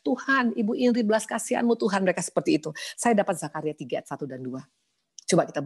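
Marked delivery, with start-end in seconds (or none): 0.0-2.0 praying friends. Retold or imitated. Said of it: "mereka semua tumpang tangan bilang".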